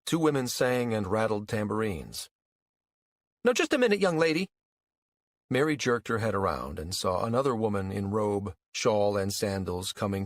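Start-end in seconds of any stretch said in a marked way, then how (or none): 3.5-4.5 high, loud indignant challenge; nasty scolding. A narrator's reading. Rival mother confronting a younger woman, nasty scolding. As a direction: pull her up sharply. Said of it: "a nasty expression on her face".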